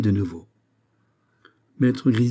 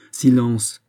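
loud, commanding call for silence; sharp command; 3 semitones higher, 6 dB louder than usual. Acted. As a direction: cut the doctor off with authority. Malade fait taire le médecin. (ordre sec, lancé d'une voix forte)

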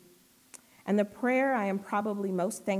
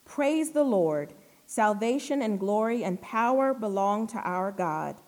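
crowd's praise shout; 4 dB louder than usual. Acted. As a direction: proclaim it like a jubilant heavenly crowd. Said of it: "a vast crowd in heaven shouting".

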